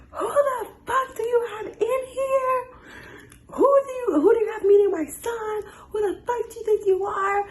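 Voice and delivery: very high, hammed-up furious screech; imitated screaming lady. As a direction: act out an outraged woman shrieking at the door. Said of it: "I hear this lady screaming".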